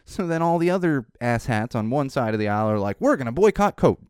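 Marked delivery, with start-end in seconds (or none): 3.0-4.1 higher, louder mocking of angry boycotters; mock outraged boycotter. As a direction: play the huffy boycotter, a bit higher.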